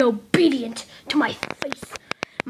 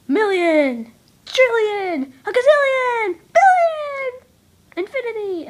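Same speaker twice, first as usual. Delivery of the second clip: climbing pitch; 8 semitones higher than usual. Pretend. Escalating numbers, voice climbing.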